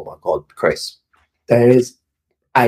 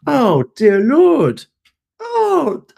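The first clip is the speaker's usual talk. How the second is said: slow, much higher, in an exaggerated voice; dismayed gatekeeper; much slower than usual. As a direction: drag out a shocked "oh, dear Lord". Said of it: "He's like".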